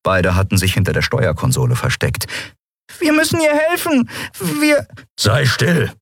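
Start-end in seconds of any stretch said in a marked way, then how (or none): 3.0-4.9 put-on pleading boy's voice. Nelen pleads, cut off. 5.2-5.9 hissed snap. Acted. Kero silences the frightened boy, sharp snap. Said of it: "fauchte Kero".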